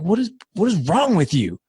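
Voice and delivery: high, exasperated acted scolding; incredulous retold outburst, high in pitch; losing cool. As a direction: re-enact a dad berating his child. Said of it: "lost my cool on my four-year-old".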